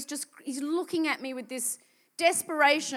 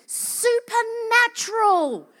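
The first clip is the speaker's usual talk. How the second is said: higher, louder, stretching supernatural out slowly; emphatic insistence; 8 semitones higher, 6 dB louder, slower than usual. Retold or imitated. The man insists to the preacher, slow emphatic supernatural. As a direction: insist hard, drawing out the word. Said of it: "He's like".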